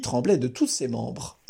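ordinary delivery

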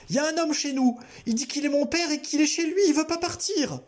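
high, frightened child's voice, lightly voiced; a hint of a crying girl; tearful little girl. A narrator's reading. Girl tells Albert about the stranger, lightly tearful. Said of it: "le visage baigné de larmes".

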